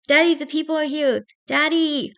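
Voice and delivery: put-on high, pleading child's call; urgent child. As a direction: call out anxiously to your father. Child calls to unconscious father.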